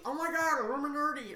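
high, loud hammed-up gasp; mock awe. Mocking the game's plot twist, high loud mock awe. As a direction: sell it like a cheesy reveal. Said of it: "It's going down the".

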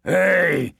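giant's challenge; roar. Acted. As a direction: shout him down. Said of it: "he roared".

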